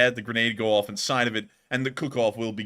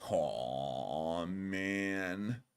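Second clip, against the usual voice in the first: low, lazy stoner drawl; 7 semitones lower, 12 dB quieter than usual. Pretend. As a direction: sigh it like a burnout. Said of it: "that stoner voice you like to do".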